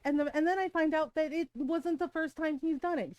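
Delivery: ordinary talk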